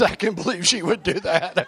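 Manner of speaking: can barely get it out for laughing; real laughing while quoting. Real, not acted. Quoting Paul amid laughter.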